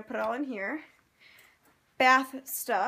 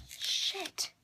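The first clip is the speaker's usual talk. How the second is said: softer, repeated panicky whisper of shit; whispered cursing; 6 semitones higher, 6 dB quieter, much slower than usual. Real, not acted. Worried vlogger whispering curses, afraid her parents will be angry. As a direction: mutter shit over and over in a soft panic. Scared checking laptop case.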